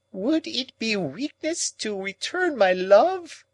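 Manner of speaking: put-on high, slow old woman's plea; exclaiming. A narrator's reading. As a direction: plead it in a high anguished voice. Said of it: "exclaimed Miss Havisham".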